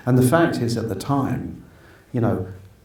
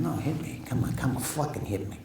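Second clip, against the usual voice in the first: Joe Pesci impression; 4 semitones higher, 8 dB quieter than usual. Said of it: "Do you wanna hear Joe Pesci?".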